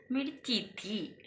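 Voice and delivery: soft, slow little-girl voice, lightly imitated; sweet child imitation. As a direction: gently echo the little girl's sweet complaint. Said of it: "कितने प्यार से बोला उसने". (प्यार से, धीमी आवाज़ में बच्ची की हल्की नकल)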